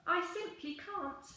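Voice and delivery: lightly voiced, high and apologetic; reluctant young dinosaur. A narrator's reading. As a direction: voice a young dinosaur gently refusing. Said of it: "And he said".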